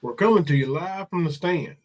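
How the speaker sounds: normal talking voice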